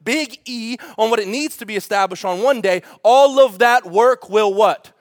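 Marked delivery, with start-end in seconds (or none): 3.0-4.9 loud, slow preacher's prompt; rhetorical call-out. Real, not acted. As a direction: draw out the question to the crowd. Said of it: "asks congregation to respond".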